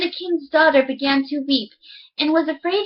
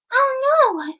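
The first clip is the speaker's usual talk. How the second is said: sudden high startled voice; pitched up in fright; high frightened princess; 11 semitones higher than usual. Acted. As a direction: squeak it out in alarm.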